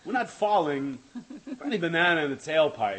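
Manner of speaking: loud, high, punchy phrases with pauses; halting, over-projected quote; exaggerated impersonation. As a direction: break the line into loud, emphatic chunks. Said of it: "It sounded like Yoda".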